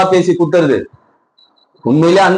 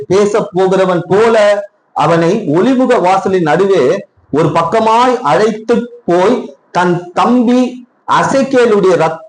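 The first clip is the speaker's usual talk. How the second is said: slow, raised scripture reading voice; 4 semitones higher, slower than usual. Acted. Preacher reading Samuel to the congregation, slow and raised. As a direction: read each phrase out slow and high.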